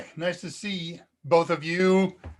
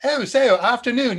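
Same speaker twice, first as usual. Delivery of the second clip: higher, louder put-on British greeting; mock British accent; 7 semitones higher, 6 dB louder than usual. Host shows viewers how to hear his typed greeting, mock British accent. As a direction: play up a hammy British accent. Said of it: "I'm typing that in a British accent".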